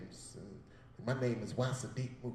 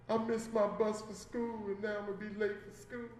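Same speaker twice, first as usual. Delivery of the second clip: put-on childlike whine; 6 semitones higher, 3 dB quieter than usual. Child self voiced plaintively.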